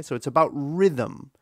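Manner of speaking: ordinary talk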